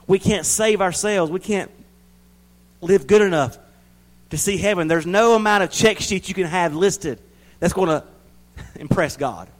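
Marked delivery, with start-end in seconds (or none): 0.1-6.4 preaching at full force. Acted. Preacher crying out faith to the church, loud and high. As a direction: lift the church with every line.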